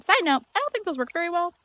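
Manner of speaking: wired and fast, overcaffeinated; too much caffeine. Real, not acted. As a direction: rattle it off buzzing on caffeine. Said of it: "I know I sound caffeinated".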